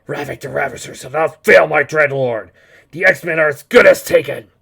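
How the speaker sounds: forceful henchman, rising in volume; loud boast. Acted. Henchman promising his Dreadlord the X-Men, loud boast. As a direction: proclaim success to your master.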